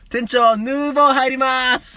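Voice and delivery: high, drawn-out shop call; host club call. Acted. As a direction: sing it out to the back room.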